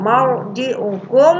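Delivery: "Marji'ukum" slowly with a heavy r; drawn-out recitation of "Marji'ukum", r full and rounded; thick r. Shown as a demonstration. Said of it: "ro tafhim dibaca tebal".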